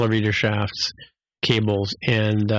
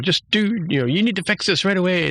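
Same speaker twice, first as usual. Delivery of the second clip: mock yell; 9 semitones higher than usual. Tendi ordering her cousin, mildly acted high yell. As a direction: imitate telling someone off, pitched up. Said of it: "yells at him".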